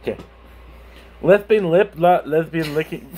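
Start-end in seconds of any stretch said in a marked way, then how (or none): 0.0-0.2 put-on "Liberally" in a light Midwestern accent; lightly put-on Midwestern accent. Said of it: "Give me a Midwestern accent".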